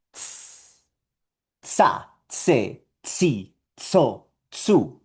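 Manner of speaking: isolated "Ts", then short separate syllables on it; T plus S. Shown as a demonstration. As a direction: drill "tsa, tse, tsi, tso, tsu", each with sharp ts. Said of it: "la combinación de un sonido de T con".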